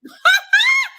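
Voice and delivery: loud, high-pitched laugh; burst of laughter. Real, not acted. Reactor cracking up at the house argument, loud laughter. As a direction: burst out cackling.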